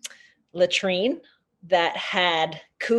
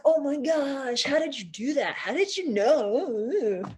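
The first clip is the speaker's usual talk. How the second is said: jazzed amazement; 6 semitones higher, 4 dB quieter than usual. Retold or imitated. Teens to their guide after finding the owl, acted amazement. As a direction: gush like an amazed, imitated teenager. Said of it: "they were just jazzed".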